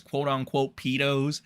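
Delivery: ordinary talk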